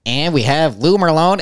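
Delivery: booming announcer, slow and high; home run call. Pretend. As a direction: call it like a radio broadcaster. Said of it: "give us a home run call".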